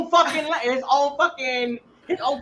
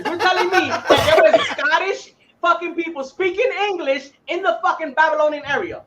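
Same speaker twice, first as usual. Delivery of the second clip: high-pitched, amused disbelief; incredulous; 6 semitones higher, 4 dB louder than usual. Real, not acted. Friend scoffing at Scottish English in Babylon, incredulous. Mocking disbelief at the accent argument.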